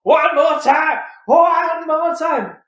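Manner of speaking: shouting commentator, the last one slow; imitated shout. Mimicking J R yelling at the ring. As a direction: yell it like a commentator losing it. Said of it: "I mean J R".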